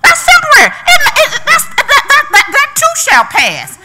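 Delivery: high, loud, laughing preach; half laugh, half shout the hope; laughing full-force preaching. Real, not acted. Preacher mocking empty checkbook, laughing high preaching.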